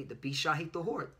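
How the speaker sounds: ordinary talk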